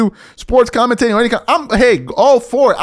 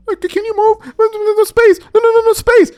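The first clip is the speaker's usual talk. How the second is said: high whiny mock voice; whiny hallway complainer; 11 semitones higher than usual. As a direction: play a fussy stranger complaining, pitched up.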